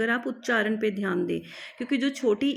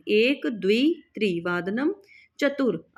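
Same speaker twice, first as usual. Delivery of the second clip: ordinary delivery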